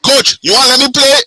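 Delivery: put-on Liberian accent. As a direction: pitch yourself to a coach in a Liberian accent. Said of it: "we gotta put it in our Liberian accent".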